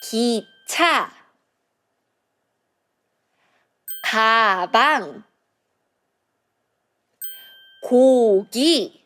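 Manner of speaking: 기차, 가방, 고기 each said clearly with a pause; teacher modeling three words. Shown as a demonstration. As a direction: model 기차, 가방, 고기 for repeating. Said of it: "Repeat after me".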